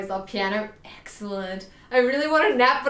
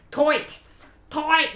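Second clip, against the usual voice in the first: toit with broad Australian oi vowel; 6 semitones higher, 4 dB louder than usual. Shown as a demonstration. Showing Australian pronunciation of tight, Australian accent. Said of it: "That's how you pronounce it in ... Australia".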